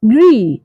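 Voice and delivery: "gre" as a firm GREE; stressed syllable alone. Shown as a demonstration. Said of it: "the stress is on the second syllable".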